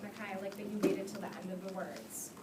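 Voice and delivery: ordinary talk, nothing marked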